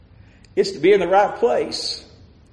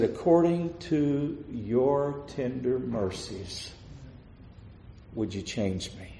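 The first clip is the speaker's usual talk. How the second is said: much softer, slow pleading prayer; hushed plea; 4 semitones lower, 11 dB quieter, slower than usual. Acted. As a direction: let it fade almost to nothing as you ask. Quiet plea for mercy.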